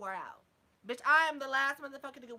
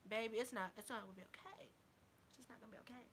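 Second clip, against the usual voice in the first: fading down to a much softer hush; much softer ominous warning, dropping away; 12 dB quieter, faster than usual. Acted. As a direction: let it sink to a menacing near-whisper. Warning about her fighting.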